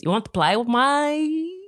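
slow, high mimicked accent, vowels stretched; exaggerated accent with PLAY said like "ply"; child's put-on accent. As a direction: imitate a kid's drawling play accent, rising at the end. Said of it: "he does accents all the time".

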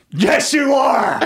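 slow, loud, high proud yell; proud shout. Retold or imitated. Dad cheering his son's comeback, proud shout. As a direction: bellow it out with pride.

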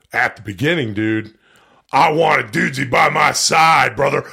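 loud gruff wrestler promo; wrestling promo voice. Retold or imitated. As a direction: shout it like a Hulk Hogan promo. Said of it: "now you're like".

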